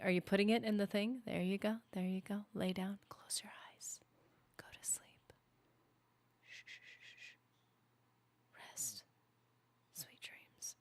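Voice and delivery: soft voice fading into a whisper; hushed lullaby whisper. Real, not acted. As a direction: whisper someone gently to sleep. Hushed putting someone to sleep.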